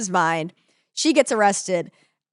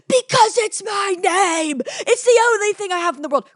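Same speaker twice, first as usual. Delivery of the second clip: melodramatic monologue; 12 semitones higher, 5 dB louder than usual. Retold or imitated. John Proctor refusing to sign, retold as a hammed-up high loud wail. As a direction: ham it up like a stage hero clinging to his name. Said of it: "Great monologue. ... freaking out".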